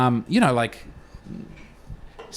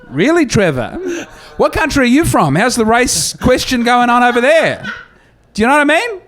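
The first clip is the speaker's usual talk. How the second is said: mock outrage; 9 semitones higher than usual. Real, not acted. Mocking rant at Trevor.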